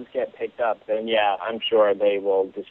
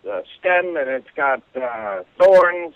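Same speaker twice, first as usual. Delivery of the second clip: put-on higher old-man voice groping for a word; old man can't recall the flower's name, higher hesitant voice; forgetful old man; 7 semitones higher than usual. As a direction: fumble for the word like a muddled old guy. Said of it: "And he says".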